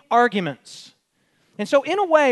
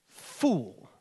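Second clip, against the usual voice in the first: lower, softer, grave single word; a quiet weighty fool; solemn verdict; 6 semitones lower, 5 dB quieter than usual. Acted. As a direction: pronounce it as God's judgment. God's verdict in parable.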